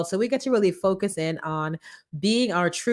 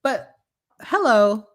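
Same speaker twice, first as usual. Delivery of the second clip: ordinary talk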